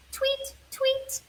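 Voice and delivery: soft high birdlike tweeting; light cheeping tweets; bird tweets. Acted. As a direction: chirp it softly like a little bird. Bird sound in children's story.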